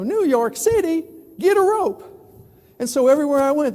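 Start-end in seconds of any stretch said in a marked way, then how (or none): 0.0-2.0 high, loud, slow mocking Texan cry; mock Texan lynch mob. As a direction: ham up an outraged cowboy. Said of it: "they go".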